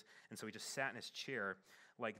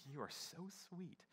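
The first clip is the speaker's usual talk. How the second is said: sweet reply; 7 semitones higher, 5 dB quieter, slower than usual. Retold or imitated. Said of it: "I'm like".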